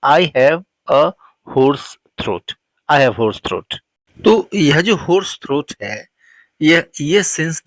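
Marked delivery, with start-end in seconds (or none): 0.1-3.8 slow, careful model sentence. Shown as a demonstration. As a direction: spell it out slowly for learners. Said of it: "तो हम कहते हैं English में". (धीमे, साफ़ उच्चारण में आदर्श वाक्य)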